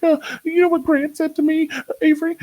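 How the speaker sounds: high, thin, put-upon mother; frail mom voice. Retold or imitated. Mom complaining to her son's friend about him, high and frail. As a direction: squeak it out like a fragile upset mom. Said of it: "his mom was so frail".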